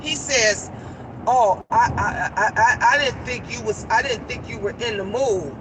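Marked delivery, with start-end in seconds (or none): 1.3-5.5 halting, lower mock stammer; stammering excuse. Imitating a scolded guest making his excuse, stammering. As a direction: trip over the first word while excusing yourself.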